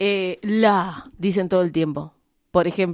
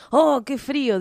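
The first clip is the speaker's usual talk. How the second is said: lightly voiced mother, higher pitch; a little higher, quoting mum's remark; mother's exclamation; 7 semitones higher, 4 dB louder than usual. Woman quoting her mother's habit of adding dijo, lightly voiced mother. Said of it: "mi vieja, que vive en Henderson".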